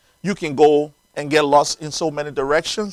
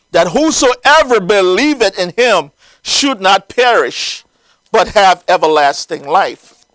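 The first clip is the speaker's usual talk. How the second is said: raised, declaimed Bible reading; scripture reading; 4 semitones higher, 7 dB louder than usual. Acted. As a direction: read the verse out solemnly to the congregation. Reads scripture to congregation.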